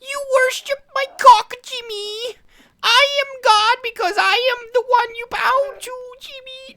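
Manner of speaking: squeaky falsetto addressed to Jimmy; cartoon kid voice. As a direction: plead at Jimmy like a whiny cartoon sidekick. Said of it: "doesn't sound like him either".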